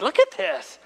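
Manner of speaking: high, thrilled call to look; excited reveal. Retold or imitated. Pastor to kiddos at the old tunnel, thrilled. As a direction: show it off to the kids, delighted.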